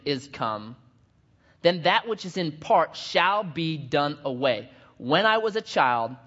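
slow, solemn Bible reading; scripture reading. Acted. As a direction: read it like holy text. Reads scripture aloud.

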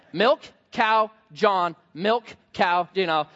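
ordinary delivery

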